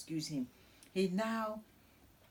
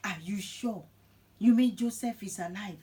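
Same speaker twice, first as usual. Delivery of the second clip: raised-pitch astonished question, growing louder; disbelieving; 4 semitones higher, 7 dB louder than usual. Retold or imitated. Jacob hearing his lost son lives, disbelieving. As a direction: ask it as if you can't believe it. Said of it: "Jacob can't believe it".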